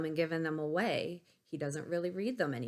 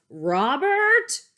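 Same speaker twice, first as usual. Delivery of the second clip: much higher, loud furious yell of a name; teacher yelling; 11 semitones higher, 14 dB louder than usual. Acted. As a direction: bellow his name like an angry teacher. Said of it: "she yells".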